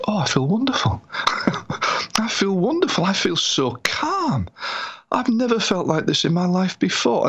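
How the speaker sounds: high, wonder-filled relief with a laugh; retold elation. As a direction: marvel at feeling suddenly freed, in imitation. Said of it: "reaction from him was maybe fifty times bigger".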